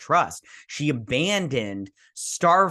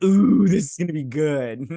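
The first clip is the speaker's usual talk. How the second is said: loud, higher, relishing ooh; gleeful anticipation; 4 semitones higher, 6 dB louder than usual. Retold or imitated. Fan watching the old crewmates meet, gleeful anticipation.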